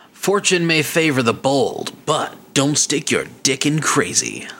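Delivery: slow, grand announcer read of the title; slow mock-solemn proverb; dramatic title read. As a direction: deliver it like an epic movie tagline, slowly. Reading email title dramatically.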